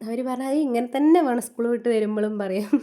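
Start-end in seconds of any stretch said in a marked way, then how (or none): 0.5-1.4 higher, louder mock insistence from the kids; a lightly voiced child insisting; insistent child. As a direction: play the fussy child demanding it just so. Kids insisting hair done so.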